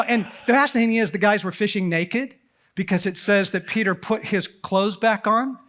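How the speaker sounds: ordinary talk, nothing marked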